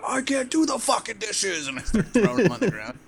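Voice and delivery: hammed-up steroid rage, high and loud; crazed agitation. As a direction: play a raging meltdown, voice shooting way up. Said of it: "crazy, like, agitation with everybody".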